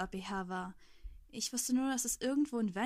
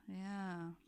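unimpressed thought; 2 semitones lower, 3 dB quieter than usual. A narrator's reading. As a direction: think it aloud with no enthusiasm. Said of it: "wobei ich mir dachte".

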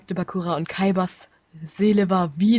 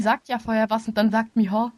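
ordinary talk, nothing marked

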